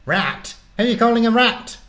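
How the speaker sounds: high, loud indignant squawk; affronted little animal, pitch shooting up; complaining guinea pig. A narrator's reading. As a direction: take offence in a quick, high creature voice. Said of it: "complained Bubbles in a voice".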